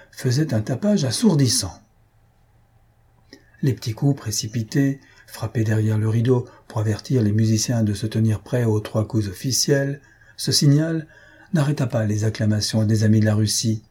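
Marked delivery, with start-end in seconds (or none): none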